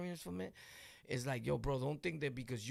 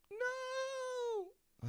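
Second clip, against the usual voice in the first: alarmed cry. Retold or imitated. Dog leaps off the ramp.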